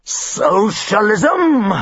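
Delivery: very slow, appalled cry; hammed-up outrage; horrified father. Father reading the letter, appalled.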